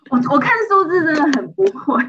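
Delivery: loud, high, fast through laughter; embarrassed laughing. Real, not acted. Student admits trouble reading numbers, laughing. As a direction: blurt it out while cracking up. Partner laughs at own mistakes.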